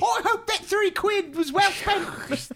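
high, slow put-on posh voice; slow high mock-British quip. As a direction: ham up a prim English gent.

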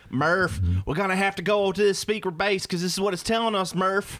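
hammed-up southern sci-fi hero; Matthew McConaughey's southern accent. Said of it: "you have a southern accent".

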